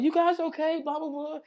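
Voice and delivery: high, loud caring soccer mom; concerned lady. Retold or imitated. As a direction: check on the crash victims brightly. Soccer mom checking on them.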